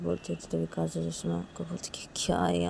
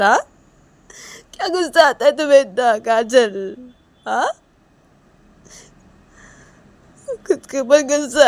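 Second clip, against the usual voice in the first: acted tearful reproach; 6 semitones higher, 14 dB louder than usual. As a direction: reproach her as if weeping. Acted Reshma tearfully reproaching Kajal.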